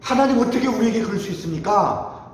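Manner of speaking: acted-out plea of grievance; resentful complaint. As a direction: protest as if wronged. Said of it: "그 원망과 불평이 많은 사람이".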